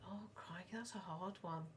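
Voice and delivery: whispered aside, voice dropping away. Retold or imitated. Mum imitating herself pondering a date venue, whispered. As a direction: breathe it like a private dilemma. Mock dilemma over date restaurant.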